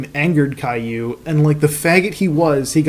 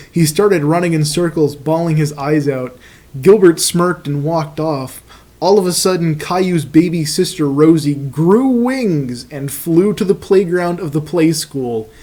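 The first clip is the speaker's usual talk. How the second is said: ordinary talk, nothing marked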